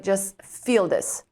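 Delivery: slow, low, soothing command; the body telling her to just feel it; body's calm voice. Retold or imitated. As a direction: speak as the body, gently. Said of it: "My body is like".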